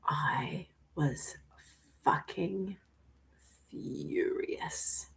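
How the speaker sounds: hushed, seething whisper; a whispered confession of rage; intense whisper. Real, not acted. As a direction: whisper the fury through clenched breath.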